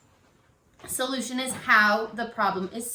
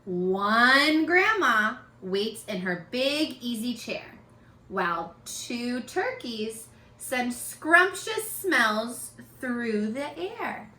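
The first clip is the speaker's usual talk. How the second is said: read-aloud storytelling. Acted. Teacher reads picture book aloud to her young students. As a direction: read it to children, savoring each line.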